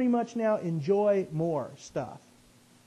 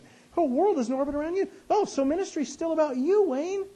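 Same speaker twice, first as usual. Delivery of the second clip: self-mocking; 11 semitones higher, 4 dB louder than usual. Mocking himself.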